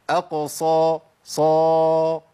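صا said with pursed lips, heavy emphatic; صا loud and rounded like a pout; pouted emphatic sad. Shown as a demonstration. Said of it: "التبويزة تجيب معايا صاد". (صا بتبويزة وتفخيم)

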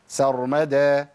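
سر مدى short and light, no added vowel; سر مدى said without effort; easy correct. Shown as a demonstration. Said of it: "ما فيش أي مجهود". (سر مدى بسهولة)

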